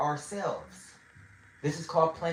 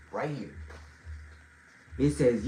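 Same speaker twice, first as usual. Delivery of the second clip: ordinary delivery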